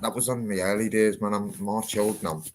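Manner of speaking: ordinary talk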